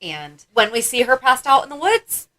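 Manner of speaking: normal talking voice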